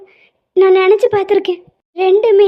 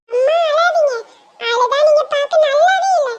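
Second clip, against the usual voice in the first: put-on higher small child's voice; a little kid consoling mum; 9 semitones higher than usual. Child comforting crying mother.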